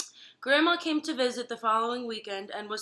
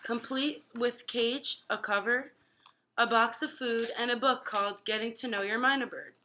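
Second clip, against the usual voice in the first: ordinary delivery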